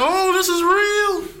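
high, slow frightened gasp; stretched-out horrified realization; scared moviegoer. Retold or imitated. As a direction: audience member spooked by the sleepwalking boy.

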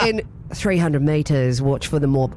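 put-on a half-hearted, lapsed Kiwi accent; failed Kiwi accent. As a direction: attempt a Kiwi sat nav voice but lose the accent. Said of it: "I've just lost my accent".

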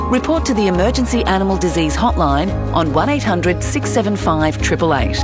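ordinary delivery

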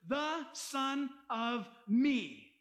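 "The Son of me" drawn out word by word; "The Son of me" very slowly, a gap between words; slow, emphatic wording. Shown as a demonstration. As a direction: give each word of "The Son of me" its own slow beat. Stressing the original wording for listeners.

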